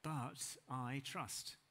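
very slow, weighty but; slow, grave turn to trust. Acted. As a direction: stretch each word out. Turning point, drawn out.

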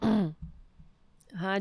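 normal talking voice

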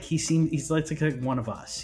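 ordinary talk, nothing marked

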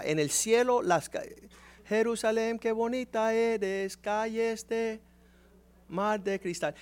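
a hint of sing-song mock piety; mock churchy voice. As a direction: parody heavenly talk, drawn out. Mocking the heavenly hymn imagery.

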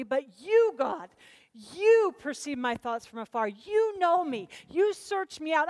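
loud, high punch on every "you"; impassioned, stretched stress on "you"; emphatic YOU. Acted. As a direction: declare it to God, hammering "you". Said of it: "go back to that emphatic".

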